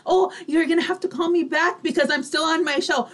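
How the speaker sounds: ordinary delivery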